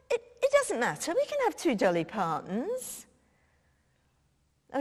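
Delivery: normal talking voice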